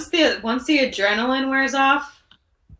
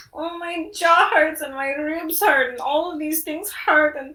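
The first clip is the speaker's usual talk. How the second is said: higher-pitched whiny griping about aches; loud complaining; 4 semitones higher than usual. Acted. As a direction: complain like everything aches, ending in a groan. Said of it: "now she's just complaining loudly in Castilian".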